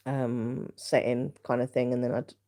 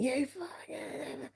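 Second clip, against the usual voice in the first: slow, hushed mock snarl of an angry mum; imitating angry mum; 12 semitones higher, 9 dB quieter, much slower than usual. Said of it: "She's so angry".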